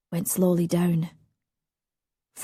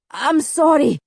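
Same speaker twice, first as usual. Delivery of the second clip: anguished; 9 semitones higher, 5 dB louder than usual. Acted. Anguished apology in dream.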